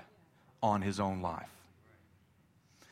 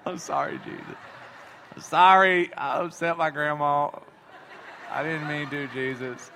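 laughing apology; 3 semitones higher, 3 dB louder than usual. Retold or imitated. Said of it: "My grandma's like".

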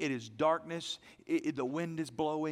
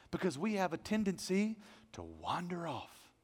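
ordinary talk, nothing marked

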